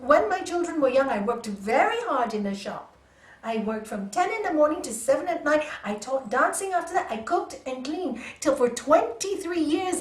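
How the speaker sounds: loud lecturing rant; rude scolding. Retold or imitated. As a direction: list it all at her, loud and stern. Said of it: "I was really quite rude to her".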